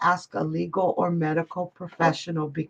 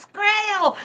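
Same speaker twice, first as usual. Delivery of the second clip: high, delighted call of his name; a high, happy hello shout; excited greeting; 11 semitones higher than usual. Real, not acted. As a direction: greet him with a squeal of joy. Excited greeting to a chat friend.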